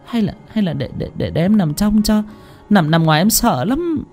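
frightened wife asking to sleep inside; scared pleading. Acted. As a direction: beg timidly, afraid of the window side. Scared wife begs to swap sides.

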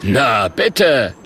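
loud, satisfied showman; calling out. Acted. The Murk welcoming a paying customer, loud satisfied call. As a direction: call it out with a flourish. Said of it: "ruft der Murk".

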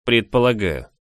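noncommittal reply; evasive. A narrator's reading. Friend dodges her question, mildly evasive. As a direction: answer without committing yourself. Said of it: "уклончиво ответил парень".

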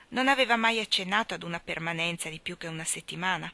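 ordinary delivery

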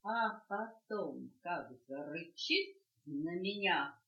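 usual speaking voice

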